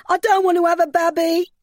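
loud, very high tearful wail; sobbing. A narrator's reading. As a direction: wail it out in distress. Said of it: "she sobbed".

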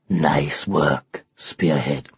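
very low, confiding lion; lion's voice. Acted. Lion congratulates the unicorn out of earshot, low and approving. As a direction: drop right down into a lion's rumble.

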